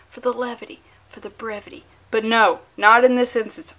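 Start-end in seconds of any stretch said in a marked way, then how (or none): 0.1-1.8 soft unvoiced whisper of praise; whispered aside. Viewer praise, whispered like a secret. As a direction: breathe the rhyme like a secret.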